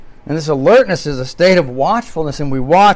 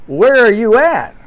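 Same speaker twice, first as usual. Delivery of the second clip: challenging call; 6 semitones higher, 4 dB louder than usual. Acted. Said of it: "then you gotta say".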